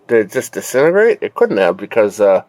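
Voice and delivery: ordinary talk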